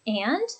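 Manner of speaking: "and" lifting at the end; questioning rise on "and"; rising intonation. Shown as a demonstration. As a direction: say "and" as an upturned prompt to continue. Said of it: "with that rising intonation".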